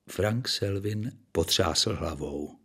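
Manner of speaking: usual speaking voice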